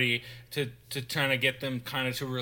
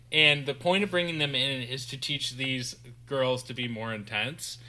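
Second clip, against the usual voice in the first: ordinary delivery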